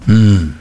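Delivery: loud, closed-mouth "hm" hum; grunted hm. A narrator's reading. Barkis mulls over the passenger's question, grunting. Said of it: "brummte Mister Barkis".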